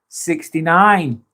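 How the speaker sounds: ordinary talk, nothing marked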